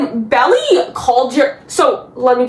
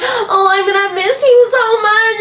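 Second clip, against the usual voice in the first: higher, whiny mock-sad parody; mock clinginess; 7 semitones higher than usual. Vlogger mocking Jeremiah's reply about Paris, whiny imitation. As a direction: mimic a lovesick whimper, stretching the ending.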